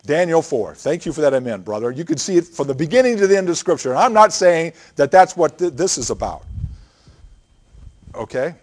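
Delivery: usual speaking voice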